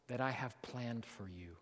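very soft and low; gentle voicing of God's promise; hushed, tender promise. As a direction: drop right down, almost to yourself. God's call, ending very softly.

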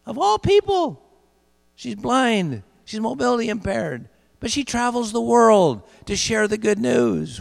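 high, loud, rising fervour; preaching at full force. Real, not acted. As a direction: lift the congregation with it.

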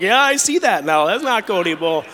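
high, loud alarmed double take; suddenly seeing a stranger in the light; startled realization. Retold or imitated. Homeowner realizing the guest is a stranger, loud alarmed.

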